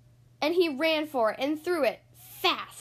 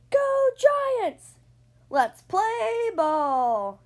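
high, loud cheer, slow on the last; yelling; 8 semitones higher, 6 dB louder, much slower than usual. Acted. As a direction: yell it to the whole team. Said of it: "she yelled".

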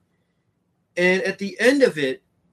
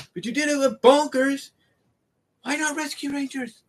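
exasperated disbelief; 10 semitones higher than usual. Real, not acted. Fan venting at Disney over the missing crossover, exasperated and higher. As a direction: throw up your hands at the studio.

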